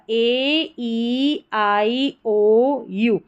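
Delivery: ए, ई, आई, ओ, यू each drawn out; slow recital, holding ए, ई, आई, ओ, यू; long vowels. Shown as a demonstration. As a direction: stretch each of ए, ई, आई, ओ, यू separately. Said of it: "लंबा उच्चारण है इनका".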